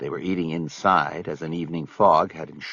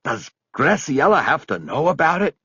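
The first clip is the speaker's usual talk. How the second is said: higher, begging question; pleading; 8 semitones higher, 4 dB louder than usual. Acted. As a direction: plead with him not to tell. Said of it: "Buddy asked in a pleading voice".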